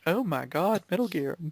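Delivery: ordinary delivery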